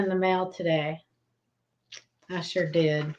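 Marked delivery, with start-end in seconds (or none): 2.3-3.1 funny put-on voice. Telling viewers about the coins in the mail, in a low, soft put-on voice. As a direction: lower and softer, a playful put-on voice. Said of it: "I don't know why I'm talking like that".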